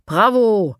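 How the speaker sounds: loud cheering bravo; calling out. Acted. Eda praises the children from a distance, loud cheer. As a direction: cheer them from afar. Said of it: "ruft Eda".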